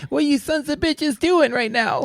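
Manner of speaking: very high, slow cursing-dog voice; squeaky, drawn-out demanding pup; puppy voice. Acted. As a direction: voice a bossy little dog, way up high. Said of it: "We do have a voice for her".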